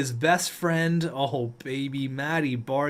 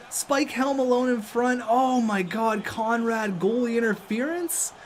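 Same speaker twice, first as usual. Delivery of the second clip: normal talking voice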